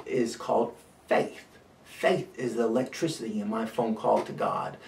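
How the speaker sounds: normal talking voice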